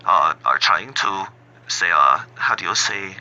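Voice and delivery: hammed-up German accent with broken English; lightly done German accent, comic; mock German accent. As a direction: play a clumsy fake German accent to mock hecklers. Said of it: "I've been working on my German accent".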